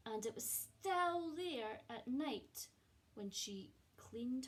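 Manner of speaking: expressive storybook reading with hushed moments; gentle picture-book narration; reading to children. A narrator's reading. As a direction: read it like a cosy bedtime story to a class. Teacher reads picture book aloud.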